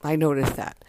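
ordinary talk

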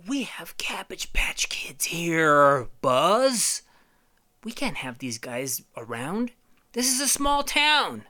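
slow high whispered warning; Woody. Acted. Woody warning Buzz about newcomers, slow hushed high voice. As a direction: gossip like a suspicious townsman. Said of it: "And Woody's like".

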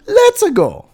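high-pitched cartoon plumber catchphrase; Mario. Host offers another catchphrase to friends, high Mario voice. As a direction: pipe it up as a game hero. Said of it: "That's still Mario".

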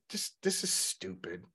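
hushed, half-whispered mutter; muttered under breath. Retold or imitated. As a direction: mutter it to yourself. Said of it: "but I'm like".

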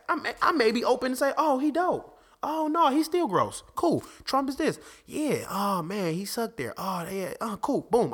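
carefree pretend inner voice; playful musing. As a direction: toss each opinion off lightly, way up high. Acts out his own open-minded musing.

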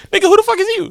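very high, quick mock challenge; high-pitched imitation of his retort; mocking comeback. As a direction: squeak out the indignant question. Listener imitates the habitual comeback.

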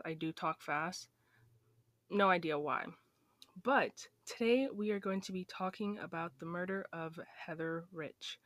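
ordinary talk

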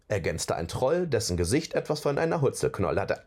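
plain reading voice

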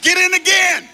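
loud high shout of get in again; shouted command. Acted. Preacher shouting at the congregation to get baptized again. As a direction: bellow get in again at him.

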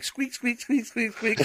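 soft high mock squeaks; squeaking. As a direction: squeak like a toy, softly. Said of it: "I'm squeaking and laughing".

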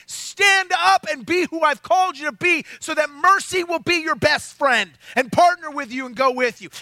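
ordinary talk, nothing marked

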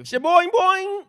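high, slow, springy boing-boing; bouncy springy boingy voice. Pretend. Mocking sound effect, laughing.

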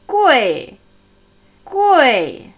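Gui with a sharp falling fourth tone. Shown as a demonstration. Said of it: "Der vierte fallende Ton natürlich". (Gui mit viertem fallendem Ton)

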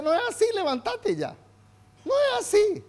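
God calling his servant to his feet; lightly voiced God lifting Joshua; voicing God. God lifts up discouraged Joshua.

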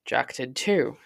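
ordinary delivery, nothing marked

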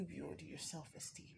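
soft unvoiced whisper. Real, not acted. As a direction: breathe the question secretly. Rhetorical question to viewers.

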